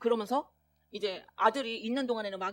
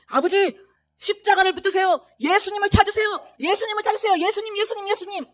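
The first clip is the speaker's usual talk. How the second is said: high, loud, pressing plea; loud urgent urging; 10 semitones higher, 9 dB louder than usual. Retold or imitated. Son urging his dying father in the ICU, loud urgent plea. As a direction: call into the father's ear, urgently.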